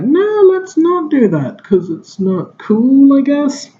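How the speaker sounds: high, slow, drawn-out mocking voice; mocking dismissal. Reviewer mimicking filmmakers refusing the right answer, mocking. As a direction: stretch it out in a silly high voice.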